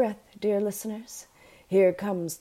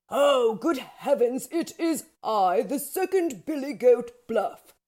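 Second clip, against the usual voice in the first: bigger booming voice; 5 semitones higher, 4 dB louder than usual. A narrator's reading. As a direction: pretend big goat, raised pitch. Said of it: "with a much bigger booming voice".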